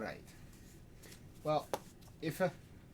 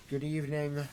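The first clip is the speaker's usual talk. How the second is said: preacher's greeting. Acted. Preacher begins sermon.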